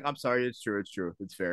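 low, soft, sheepish surrender; meek mock apology. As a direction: back down quietly, voice sinking away. Acted meek apology to angry player.

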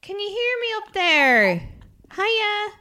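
high, loud call up to someone far above; calling from far away. Pretend. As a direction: shout up to her on her high horse. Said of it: "on the high horse".

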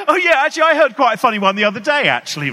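loud high cheery casualness; mock breezy party chat; fake breezy confidence. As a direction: act relaxed and jolly, too loud.

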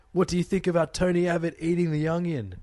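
imitated scream from afar. Audience member questioning comedian from the mezzanine, mock scream. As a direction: call out as if from the balcony. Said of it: "screamed from the mezzanine".

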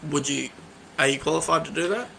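ordinary delivery

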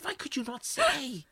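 high, soft mock-plaintive voice; upset mother. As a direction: plead in a small high voice, as a joke's mother. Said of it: "She goes".

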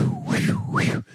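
very high whistled whoosh; quick whooshes of bikes passing; imitated whoosh.

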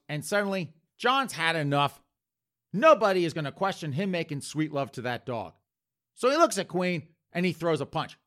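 usual speaking voice

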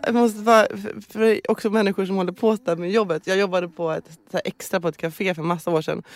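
ordinary talk, nothing marked

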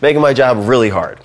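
high, loud, pleading complaint; exasperated audio engineer. Retold or imitated. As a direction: beg the loud host to stop peaking. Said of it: "they're just like".

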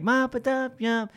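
high, mock American theme-tune chant; American accent. Mocking the theme tune, high American accent. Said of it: "like, American and".

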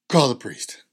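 loud, firm command; insist, no arguing; firm insistence. Retold or imitated. Mother insisting.

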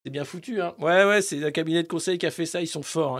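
ordinary delivery

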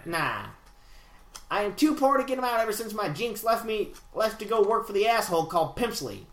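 put-on old-time radio interviewer patter; twenties gangster voice. Reading Relish Ram's email, twenties gangster voice. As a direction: talk like a vintage mobster. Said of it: "twenties interviewer voice".

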